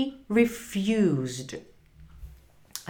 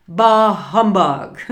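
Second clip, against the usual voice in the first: loud, grumpy dismissal; forceful scornful outburst; mean old man; 9 dB louder than usual. A narrator's reading. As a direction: play a cold-hearted miser blurting it out. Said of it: "said Scrooge. He was a mean, cold-hearted old man".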